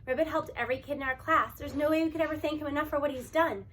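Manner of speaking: ordinary delivery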